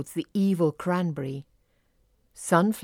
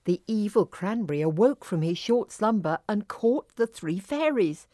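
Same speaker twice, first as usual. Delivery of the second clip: ordinary delivery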